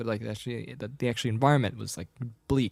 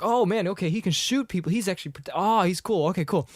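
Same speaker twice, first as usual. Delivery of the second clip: higher-pitched acted relief; mock-impressed, voice raised; impressed reaction; 7 semitones higher than usual. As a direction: play someone suddenly won over. Acting out an impressed movie character.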